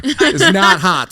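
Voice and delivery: usual speaking voice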